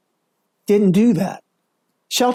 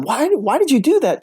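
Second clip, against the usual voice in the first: accusing why; 9 semitones higher than usual. Shown as a demonstration. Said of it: "You go from".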